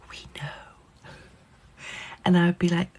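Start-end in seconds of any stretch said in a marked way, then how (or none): 0.1-1.1 hushed conspiratorial whisper; mock secret-sharing whisper; knowing whisper. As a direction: lean in and whisper it. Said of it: "they'd be like".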